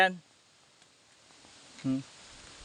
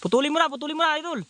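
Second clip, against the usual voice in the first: urgent calling; 9 semitones higher, faster than usual. Real, not acted. Urging companion to cut branch.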